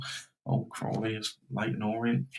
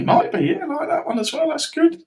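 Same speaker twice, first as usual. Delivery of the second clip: laughing; 6 dB louder than usual. Real, not acted. Laughing at a viewer's suggestion.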